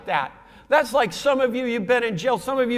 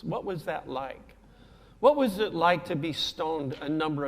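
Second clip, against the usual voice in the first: softer, awed asking; hushed wondering questions; 6 semitones lower, 6 dB quieter than usual. Pretend. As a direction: ask him gently, in quiet awe. Imagined questions to Paul in heaven.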